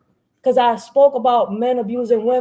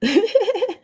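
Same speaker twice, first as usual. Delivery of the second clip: real laughter; 10 semitones higher than usual. Real, not acted. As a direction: crack up at him. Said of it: "that's why I'm over here laughing".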